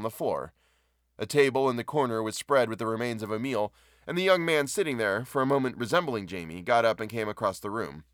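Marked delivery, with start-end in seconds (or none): none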